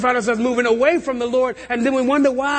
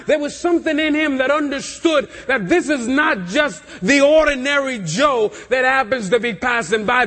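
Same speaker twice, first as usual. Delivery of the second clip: ordinary delivery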